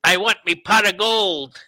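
hammed-up Irish leprechaun; greedy little leprechaun. Mock leprechaun voice.

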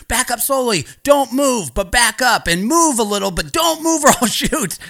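mock cop commands, pitched high; panicky cop orders. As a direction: imitate a cop urgently giving contradictory orders. Acting out cop's conflicting orders.

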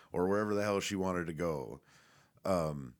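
normal talking voice

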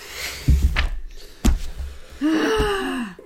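high, theatrical mock gasp; dramatic gasp. Co-host fakes shock at the book's end, theatrical gasp. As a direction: gasp as if the ending shocks you.

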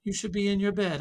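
a hint of a fussing aunt; aunt chiding grandpa for being up; aunt scolding. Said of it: "she says".

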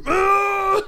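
high, loud mock scream; panicked scream. Player running from castle enemies screams high. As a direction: yelp in panic as you flee.

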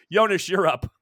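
ordinary talk

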